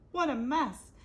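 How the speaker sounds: ordinary delivery, nothing marked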